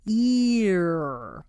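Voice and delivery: "Ear" with the tongue pulled back into the throat; E sliding to R. Shown as a demonstration. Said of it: "Now from E, pull your tongue back".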